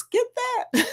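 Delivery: high, coaxing craving voice; voice of my brain. Retold or imitated. Host voicing her brain's sour candy craving, high coaxing. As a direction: let your cravings whisper-sell it, high. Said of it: "That's what my brain says".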